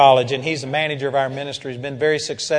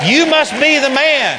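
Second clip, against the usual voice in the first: excited recognition; 9 semitones higher, 7 dB louder than usual. Retold or imitated. Buddhist woman recognizing the preacher.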